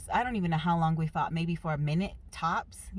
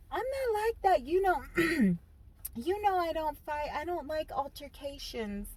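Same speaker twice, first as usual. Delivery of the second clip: high, prissy excuse voice; prim mom voice; 9 semitones higher than usual. Retold or imitated. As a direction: play innocent and proper. Mom voiced defending herself.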